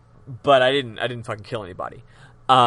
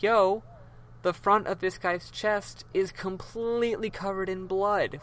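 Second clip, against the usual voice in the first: higher, drawn-attention announcement; pointed revelation; 6 semitones higher than usual. Acted. Player showing the bloody picture to court, mock amazed.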